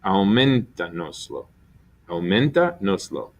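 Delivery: Aumentanoslo with MEN held as the strong syllable; stress on men. Shown as a demonstration. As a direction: keep the beat on MEN in Aumentanoslo, letting noslo follow lightly. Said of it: "that's where the accent's gonna be".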